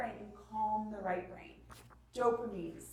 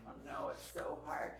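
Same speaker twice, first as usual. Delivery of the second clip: hushed commiseration, softer; mock-earnest sympathy; 7 dB quieter than usual. Mom on the floor beside her upset daughter, sympathetic. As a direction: commiserate softly with an upset child. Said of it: "like, Zoe's so upset".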